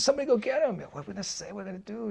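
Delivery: panicked. Retold or imitated. Narrator's panicked calling.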